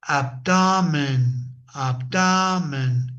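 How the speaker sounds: ABdomen, said slowly with "ab" stressed; slow, stress on the first syllable. Shown as a demonstration. Teacher modelling abdomen slowly for Spanish speakers. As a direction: draw out "abdomen" with the weight on "ab". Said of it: "we have always before it".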